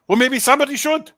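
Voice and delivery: hammed-up Austrian German comeback; Niki Lauda's Austrian accent. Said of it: "the impatient Austrian slash German".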